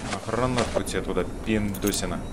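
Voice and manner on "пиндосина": usual speaking voice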